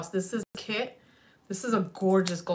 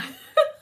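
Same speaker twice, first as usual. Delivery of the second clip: bright burst of laughter; quick delighted laugh; short high laugh; 10 dB louder than usual. Real, not acted. Laughing at own remark.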